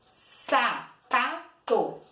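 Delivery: "Sapato" slowly split, SA, PA, TO; three separated beats in "Sapato"; slow, pause between the syllables of "Sapato". Shown as a demonstration. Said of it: "Três vezes".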